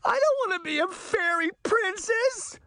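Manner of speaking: high, tearful wail; bursting into tears. A narrator's reading. Daughter refusing to be a fairy princess, high tearful wail. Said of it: "Allison burst into tears".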